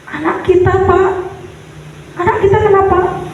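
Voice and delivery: high, choked, anxious asking; a mother fearing the worst, close to tears; tearful mother's voice, lightly acted; crying question. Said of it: "Dia nangis, nggak bisa ngomong".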